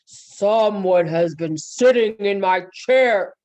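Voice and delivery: slow, booming bear voice; loud, weighty storybook bear; Papa Bear's great big voice. Acted. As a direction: thunder it slowly as a huge papa bear. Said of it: "Papa Bear said in his great big voice".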